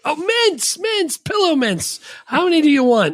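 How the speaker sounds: hammed-up high character voice; high silly voice. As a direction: squeak it out as the hotel guy. Voicing the other character.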